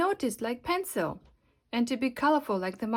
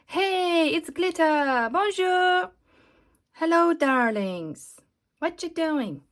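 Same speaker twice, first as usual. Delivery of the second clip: showy, raised, drawn-out diva greeting with a French flourish; flamboyant Glitter; 5 semitones higher, 3 dB louder, slower than usual. Acted. Glitter greets the crafts with a grand diva flourish. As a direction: sweep in like a glamorous star.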